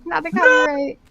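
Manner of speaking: high, loud hammed-up dismay; mock outrage. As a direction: wail it in fake horror.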